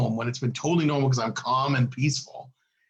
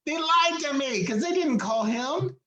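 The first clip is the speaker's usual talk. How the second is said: high, indignant complaint; 12 semitones higher than usual. Real, not acted. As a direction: spit out the injustice. Angry aside about staff lying.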